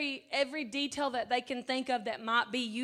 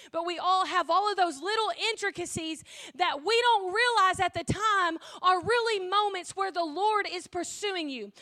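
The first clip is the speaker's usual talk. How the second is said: louder, fervent preaching voice; rising preaching; 3 semitones higher, 5 dB louder than usual. Acted. Preacher telling the congregation the Good Shepherd pursues them, fervent. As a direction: build it like a preacher reaching the altar call.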